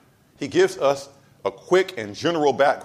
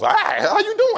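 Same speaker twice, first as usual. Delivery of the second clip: much higher, louder mimicked greeting; Miss Rosa; 5 dB louder than usual. Preacher imitates a church lady surprising him from behind, high comic voice. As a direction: pop out with a comic high-pitched hello. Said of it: "like, Miss Rose".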